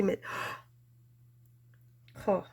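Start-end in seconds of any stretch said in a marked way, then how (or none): none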